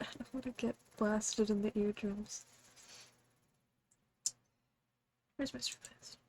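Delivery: soft unvoiced whisper. Real, not acted. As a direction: whisper it under the music. Turning music down, whispering.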